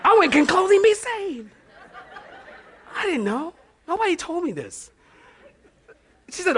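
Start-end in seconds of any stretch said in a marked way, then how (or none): 0.3-1.5 much higher, incredulous mock question; high-pitched comic astonishment; astonished question. 3.0-4.9 high, soft, innocent protest; softly pitched-up mock dismay; mock bewilderment.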